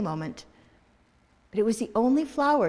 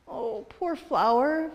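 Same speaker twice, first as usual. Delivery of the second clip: mourning; 6 semitones higher, slower than usual. Acted. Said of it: "Vivian mourned".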